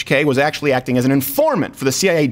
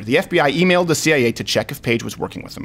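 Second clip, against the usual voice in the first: ordinary talk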